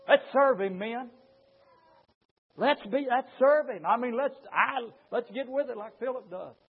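normal talking voice

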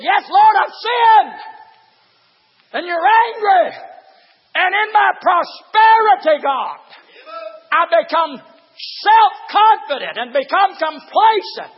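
shouted high preacher's whoop, drawn out; full-force preaching. Acted. Preacher whooping to his congregation, shouted and high. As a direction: cry it out to the congregation.

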